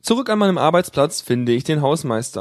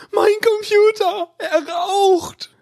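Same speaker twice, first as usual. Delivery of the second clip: shrieking; much slower than usual. A narrator's reading. Caller screaming down the phone at the admin, panicked shriek. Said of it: "schreit sie am anderen Ende".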